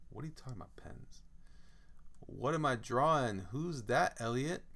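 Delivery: usual speaking voice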